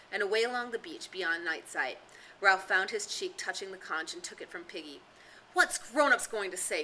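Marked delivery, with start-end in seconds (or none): 5.5-6.8 louder, higher anxious cry. Acted. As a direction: cry out in worry. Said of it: "cried Piggy again".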